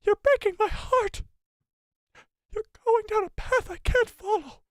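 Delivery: overwrought Padme wail; hammed-up Padme. Streamer hams up Padme's plea to Anakin, high falsetto. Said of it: "my inner Padme Amidala".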